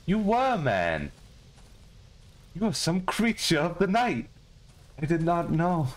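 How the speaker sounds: mock dramatic gasp. Mock dramatic discovery of creature.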